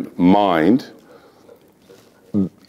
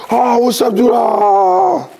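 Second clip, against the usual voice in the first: high, squealing fan greeting; mimicking fans who spot him; excited fan; 4 dB louder, much slower than usual. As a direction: shriek like a starstruck fan.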